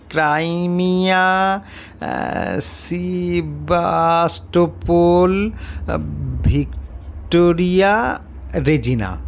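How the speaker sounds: very slow, stumbling syllable by syllable; slow halting reading. A narrator's reading. Class four boy reading the medal aloud, slowly and haltingly. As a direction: read it out like a struggling child. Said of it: "অতি কষ্টে ধীরে ধীরে পড়লে".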